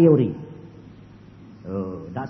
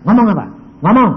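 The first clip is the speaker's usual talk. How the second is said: loud, higher back-and-forth; loud exchange; 6 semitones higher, 10 dB louder, faster than usual. Retold or imitated. Man asks God what to say, loud reply. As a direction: snap the question and answer.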